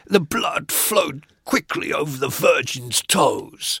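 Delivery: slow, portentous poetry reading; mock gothic recital. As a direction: intone it like dark verse. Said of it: "it was like".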